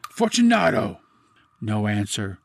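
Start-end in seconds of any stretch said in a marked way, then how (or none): 0.1-1.0 loud, high impatient call; calling aloud. A narrator's reading. Impatient captor calls to his silent victim, loudly. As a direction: call out sharply into the depths. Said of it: "I called aloud".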